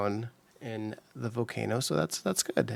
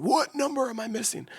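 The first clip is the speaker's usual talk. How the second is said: much higher put-on voice; high silly voice; 12 semitones higher than usual. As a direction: squeak the question up high. Repeats question in new voice.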